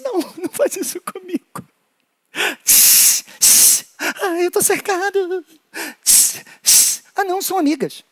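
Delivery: high squeaky scared little animal; high-pitched little creature voice, worried then relieved; tiny frightened critter. Acted. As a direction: play the cornered little critter, voice way up. Small animal voiced in hand play.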